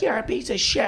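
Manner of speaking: lightly voiced irate heckler; angry lady. Comic retelling a lady's insult after the punchline, mild mock anger. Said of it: "this one lady went bananas".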